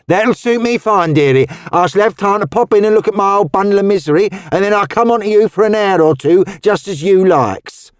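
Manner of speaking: put-on high, lively Cockney old woman; Cockney charwoman. A narrator's reading. Missus Tubbs startled by Roseanne's outfit, high lively Cockney.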